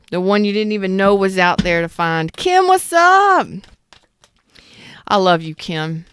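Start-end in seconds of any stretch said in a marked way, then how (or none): 2.3-3.5 high, loud, drawn-out hello; excited greeting. Real, not acted. Host greets chat regular Kim, high, loud and stretched out. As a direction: call out to Kim with delight.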